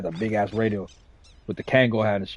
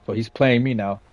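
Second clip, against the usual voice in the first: ordinary talk, nothing marked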